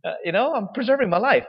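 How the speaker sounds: mock indignant complaint to God; exasperated. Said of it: "you're like".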